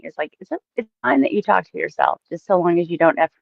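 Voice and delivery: ordinary talk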